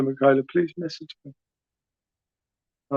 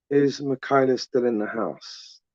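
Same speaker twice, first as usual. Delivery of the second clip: slow, softer call-out with a falling end; slow repeat; 2 semitones lower, 5 dB quieter than usual. Real, not acted. Live host checks whether a viewer is still watching, slow and softer. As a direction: draw the question out slowly and let it sink.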